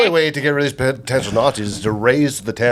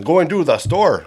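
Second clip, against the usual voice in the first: slow, higher mock Russian accent; 4 semitones higher, slower than usual. Shown as a demonstration. Trying another accent on the friends, in a weird Russian accent. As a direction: drag it out in a badly faked Russian accent. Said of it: "Let's try Russian".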